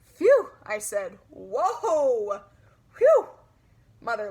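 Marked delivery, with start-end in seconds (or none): 0.1-0.5 loud high puffed-out breath; heavy phew. Acted. Girl catching her breath after the mirror fright, loud phew. 1.3-3.3 loud high gasp and puff; a big breathless whoa, whew; startled whoa then whew. Acted. Girl startled by her own clown face, gasping whoa. Catching breath after fright.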